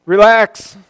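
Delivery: loud, high "Relax" to the crowd; loud playful call to relax; reassuring shout. Acted. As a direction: call out relax to settle the congregation.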